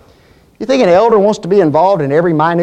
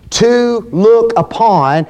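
slow emphasis; slower than usual. Real, not acted. Quoting a definition with weight.

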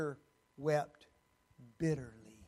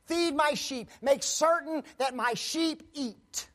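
commanding Jesus; 8 semitones higher, 6 dB louder than usual. Acted.

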